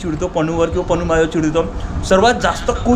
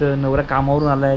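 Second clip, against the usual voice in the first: ordinary talk, nothing marked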